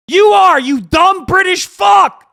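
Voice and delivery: high, loud and drawn out; furious shouted insult. Real, not acted. Streamer to British viewer on who pays, furious shout. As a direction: yell the answer straight at them.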